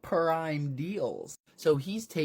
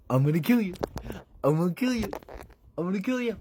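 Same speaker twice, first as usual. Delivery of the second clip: hammed-up threat; 6 semitones higher, 4 dB louder, faster than usual. Villain threatening.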